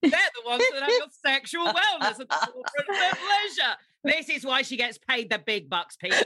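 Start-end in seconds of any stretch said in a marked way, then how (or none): 0.0-3.8 mock sales pitch, laughing. Host parodying the guest's brand slogan, laughing. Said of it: "mocking guest's brand slogan".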